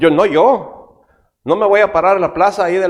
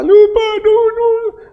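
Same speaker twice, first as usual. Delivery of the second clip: put-on high pleading kid voice, a bit louder; imitating a child begging his dad not to make him jump; scared child; 4 dB louder, slower than usual. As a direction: beg like a frightened little kid. Children afraid on the car roof.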